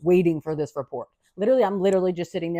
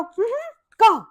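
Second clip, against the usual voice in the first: sassy dismissal; 13 semitones higher, 4 dB louder than usual. Retold or imitated. Ordering the doctor off.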